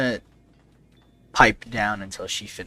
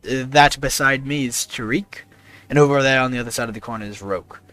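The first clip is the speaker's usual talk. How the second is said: Pierre, courteous; 4 semitones higher, 6 dB louder, faster than usual. Acted. Pierre introduces himself and party.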